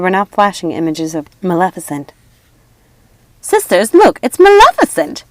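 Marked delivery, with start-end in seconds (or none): 3.4-5.2 high, loud thrilled witch cry; excited witch. A narrator's reading. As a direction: cry out in delight.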